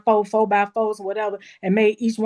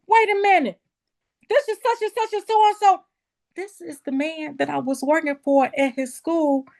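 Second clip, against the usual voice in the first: surprised; 8 semitones higher than usual. Retold or imitated. Retelling surprise at the caller's name.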